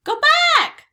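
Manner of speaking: very high, loud parrot screech; squawking parrot. A narrator's reading. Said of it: "squawked Polly".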